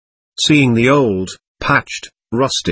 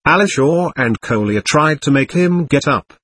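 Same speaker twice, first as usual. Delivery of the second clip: usual speaking voice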